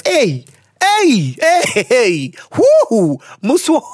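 high excited hey hey; excited shouts. Real, not acted. Host hearing the letter, shouting excitedly. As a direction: whoop at the letter's confession.

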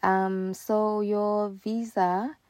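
mimicked official, words stretched long; slow talking. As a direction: drag it out with maddening slowness. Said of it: "she's talking so slow".

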